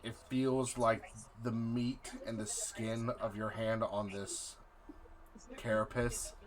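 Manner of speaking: dramatic scene-setting narration; game master narration. Acted. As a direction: set the dark scene slowly.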